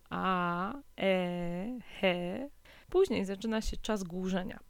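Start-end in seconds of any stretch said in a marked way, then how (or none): 0.1-2.5 drawn-out isolated vowels "a, e, he"; infant-like sounds. Shown as a demonstration. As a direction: demonstrate "a", "e", "he" as separate, stretched baby sounds. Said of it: "dźwięki podobne do".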